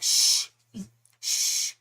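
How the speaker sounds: playful "shh" to an accomplice; drawn-out conspiratorial shushing, acting out a skit; mock hushing. Skit hushing before cranky wife.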